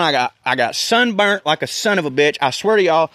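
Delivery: usual speaking voice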